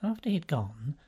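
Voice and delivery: usual speaking voice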